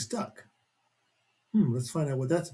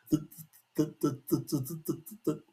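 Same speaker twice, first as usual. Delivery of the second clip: stuttering; 7 dB quieter than usual. Acted. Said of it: "that's what it would say".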